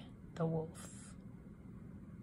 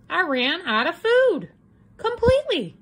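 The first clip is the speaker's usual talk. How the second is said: pitched up, louder, almost wailing; dramatic hunger; 9 semitones higher, 6 dB louder than usual. A narrator's reading. Wolf telling readers his woe, in a wailing lament. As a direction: ham up a starving wolf.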